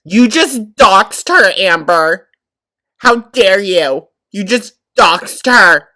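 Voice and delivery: loud, high, drawn-out accusation; theatrical shouted indignation; mock outrage. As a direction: scold her slowly at full volume, hamming up the shock. Mock outrage at Amber's doxing.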